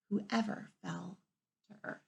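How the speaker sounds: usual speaking voice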